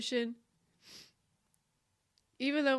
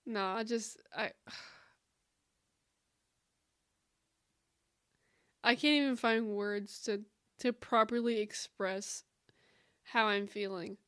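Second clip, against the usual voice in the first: choked up; 5 dB quieter than usual. Real, not acted. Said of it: "my words are just go".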